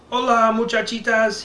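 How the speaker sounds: usual speaking voice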